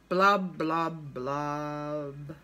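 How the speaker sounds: low, sinking blubs, last one drawn out; gloomy fish. Acted. Pout-pout fish spreads dreary wearies, gloomy low refrain. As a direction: drop down deep and glum, stretching the final word.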